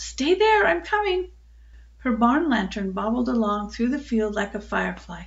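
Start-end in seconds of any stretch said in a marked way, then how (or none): 0.0-1.3 high-pitched call across a distance; raised, carrying storyteller's holler. A narrator's reading. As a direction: call back to someone far off. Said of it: "called out".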